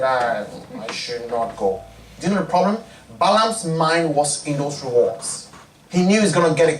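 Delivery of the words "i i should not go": lower, softer grumble; grumbling. Acted. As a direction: mutter it like a sulking child. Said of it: "he's grumbling".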